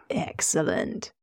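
slow, soft, sinister whisper; villain quietly delighted; whispered excellent. A narrator's reading. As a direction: savor it under your breath. Pleased at finding a victim.

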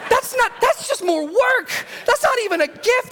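very high, comic squeal of dismay; squealed mock protest. Parents reacting to a promised puppy, squealing in mock dismay. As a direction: protest in a hammed-up falsetto whine.

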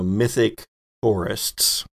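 ordinary delivery

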